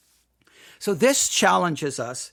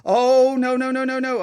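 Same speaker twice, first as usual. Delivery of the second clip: hammed-up "oh no", higher pitch; mock dismay; 7 semitones higher, 4 dB louder than usual. Host spots a new email in his inbox, mock dismay. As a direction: play at dreading what just arrived.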